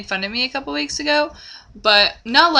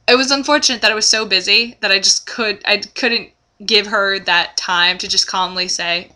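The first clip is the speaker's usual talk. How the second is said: usual speaking voice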